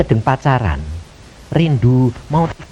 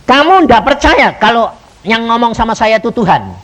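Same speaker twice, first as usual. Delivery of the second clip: raised pitch, pressing accusation; angry prophet; 6 semitones higher, 4 dB louder than usual. Retold or imitated. Prophet challenges the speaker's belief in God's word, angry. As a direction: imitate the prophet's loud, indignant challenge. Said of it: "Marah dia".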